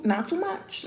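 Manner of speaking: soft, high, teasing "not too much, Pookie"; half-whispered playful plea. Real, not acted. To viewers who might tease her age, a soft high teasing plea. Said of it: "Don't come for me too".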